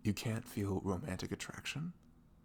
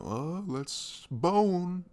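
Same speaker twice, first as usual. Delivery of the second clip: high, slow mock come-on; hammed-up casual booty call; 10 semitones higher, 7 dB louder, much slower than usual. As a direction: drag it out like a cheesy proposition. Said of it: "I don't like being like".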